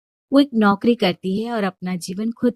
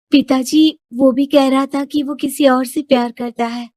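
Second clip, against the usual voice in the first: lightly voiced young daughter, a little higher, pleading; higher-pitched young woman telling her father; Shilpi's voice; 6 semitones higher, 3 dB louder than usual.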